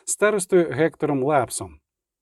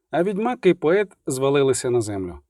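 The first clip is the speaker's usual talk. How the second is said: plain reading voice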